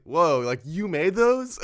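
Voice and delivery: high, loud, wide-eyed whoa; childish wonder. Retold or imitated. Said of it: "came off a little childish".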